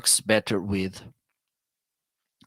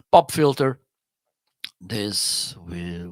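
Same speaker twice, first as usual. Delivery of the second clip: usual speaking voice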